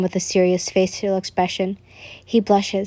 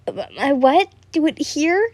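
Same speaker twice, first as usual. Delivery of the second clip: surprised; 7 semitones higher than usual. A narrator's reading. Shocked reaction to request.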